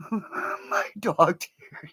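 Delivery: ordinary talk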